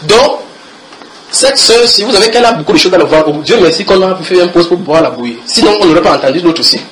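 loud, fervent preacher's testimony; loud sermon voice, testimony told with conviction; preaching at full force. Acted. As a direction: proclaim it to the whole church. Preaching to the congregation.

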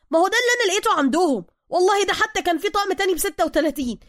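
high, loud aggrieved outburst; hurt shouting. A narrator's reading. Suka running after Bruce, shouting his grievance. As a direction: call after him, wounded and indignant. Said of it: "جرى سوكا وراءه هاتفا".

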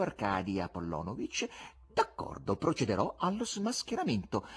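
high-pitched, sing-song mock courtesy; insolent buffoon. Buffoon humouring a pompous spectator, insolently polite. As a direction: swoop up and down like a cheeky showman. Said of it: "l'insolente buffone".

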